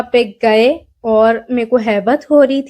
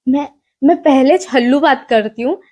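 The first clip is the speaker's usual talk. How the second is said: normal talking voice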